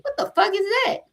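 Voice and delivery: retelling her furious snap at the detective; heated outrage. Retold or imitated. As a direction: pitch shoots way up on the retort. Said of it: "I was fucking heated".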